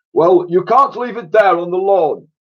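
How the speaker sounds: loud barking army voice; roaring sergeant major. A narrator's reading. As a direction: bark it like a sergeant on parade. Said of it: "roared the sergeant major".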